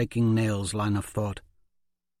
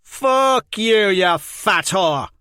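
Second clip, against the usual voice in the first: much higher, loud spitting curse; spat insult; 10 semitones higher, 9 dB louder, much slower than usual. Acted. Jenko spits a curse at Liz Hen, loud and vicious. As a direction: spit it at her. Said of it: "Jenko spat".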